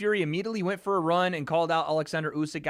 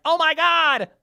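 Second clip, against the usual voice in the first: high, loud whiny mock panic; mocking chat; 11 semitones higher, 8 dB louder than usual. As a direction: mimic the chat freaking out. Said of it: "you're like".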